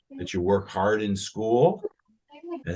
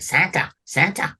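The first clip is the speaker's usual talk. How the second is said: a hint of an excited helper; lightly voiced eager elf; 6 semitones higher than usual. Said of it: "he talks like that".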